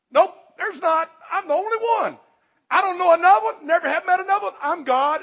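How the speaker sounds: God bragging there is no other; jovial God voice. Acted. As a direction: proclaim it cheerfully as God. Preacher voices God replying to Isaiah.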